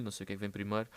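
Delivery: ordinary delivery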